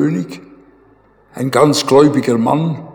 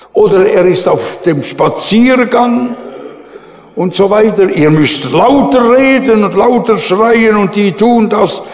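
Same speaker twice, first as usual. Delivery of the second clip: higher, louder taunt; 5 semitones higher, 4 dB louder than usual. Retold or imitated. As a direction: egg them on with scorn. Prophet taunting Baal priests.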